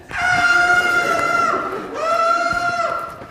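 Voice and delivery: very high, very loud terrified scream; hammed-up screaming fall; free-fall scream. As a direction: scream the whole way down.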